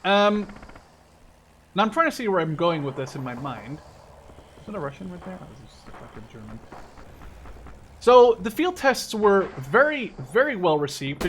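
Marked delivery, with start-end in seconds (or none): 4.6-6.6 quick soft mutter on spotting a soldier; hushed, tense aside to himself; quiet muttering. Real, not acted. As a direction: mumble it under your breath. Spotting an enemy mid-game.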